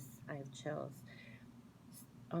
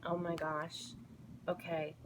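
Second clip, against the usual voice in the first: choked up. Real, not acted. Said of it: "You won't be able to speak like I just was".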